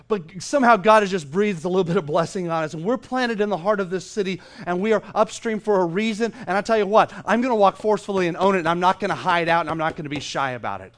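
loud, rousing declaration; impassioned preaching. Real, not acted. Preacher declaring the church planted in the city for a reason, loud and impassioned. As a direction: build with forceful conviction.

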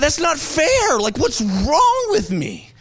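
high, loud whiny protest; mock indignation. Pastor retelling his frustration at crying, loud whiny protest. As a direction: complain like it's unfair. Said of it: "And so I thought".

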